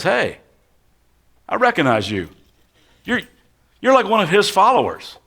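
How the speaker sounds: single him out across the crowd; accusing bystander. Acted.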